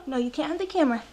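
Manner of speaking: normal talking voice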